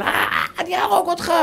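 high mock child threat; angry child. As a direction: play a raging kid, high and pinched. Child raging, voiced. (ילד משתולל מאיים, בקול גבוה)

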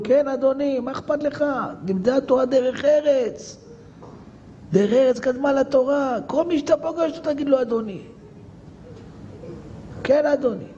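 high, impassioned sermon voice; impassioned preacher; fervent preaching. Acted. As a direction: preach it with rising fervour. Preacher in heightened preaching pitch.

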